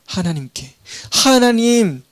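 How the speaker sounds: usual speaking voice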